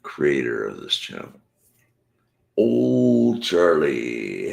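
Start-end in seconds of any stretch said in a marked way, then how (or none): 2.6-4.5 drawn-out greeting. Real, not acted. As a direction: call the name out slowly across the room. Greeting a chatter warmly.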